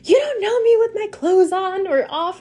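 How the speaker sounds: high-pitched mock yell; mock-shout an indignant comeback; imagined yell. Storyteller's comeback to the driver, mock yelling. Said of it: "turn around and yell".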